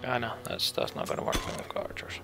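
ordinary delivery

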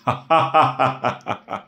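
derisive, taunting cackle; mocking laugh. A narrator's reading. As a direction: laugh scornfully at an opponent. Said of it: "أطلق نور ضحكة ساخرة عالية مستفزة".

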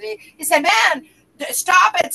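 ordinary delivery

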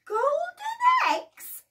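high, loud astonished question; can't believe her eyes; amazed question. Acted. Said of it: "said the mother".

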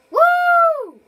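high loud cheer of triumph; excited whoop. Real, not acted. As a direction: whoop with joy. Celebrating a game success.

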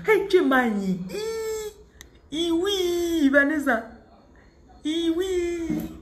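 high, drawn-out squeals of delight; thrilled, high-pitched celebration; excited squeal. Real, not acted. Exclaims over the blessed woman's husband.

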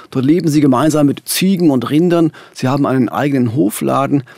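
ordinary delivery

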